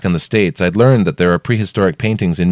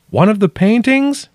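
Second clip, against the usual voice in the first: high called question; calling down; 3 dB louder than usual. A narrator's reading. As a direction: call down, trying to understand.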